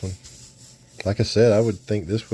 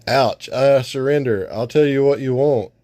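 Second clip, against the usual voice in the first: high, loud yelp of surrender; pained surrender; 6 semitones higher, 5 dB louder than usual. Acted. Spy held by eye stems, high pained surrender. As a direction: give up fast.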